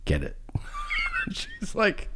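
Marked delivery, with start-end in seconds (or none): 0.1-0.4 low soft push; soft pushy murmur; hushed insistence. Retold or imitated. Insisting, retold quietly. 0.5-1.3 breathy laugh. Real, not acted. Laugh after insisting.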